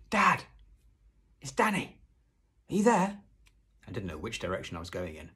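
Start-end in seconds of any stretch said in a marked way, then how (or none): 0.1-3.2 high, loud calls through the wood; shouting. A narrator's reading. Boy calling for his missing dad, shouting. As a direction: shout to someone far off. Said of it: "I shouted".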